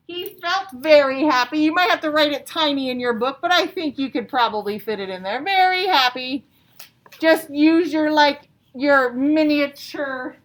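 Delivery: loud, bright classroom emphasis; teacher projecting to pupils at home and in class; emphatic. Real, not acted. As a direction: sell the answer to the whole class. Teacher emphasising answer loudly.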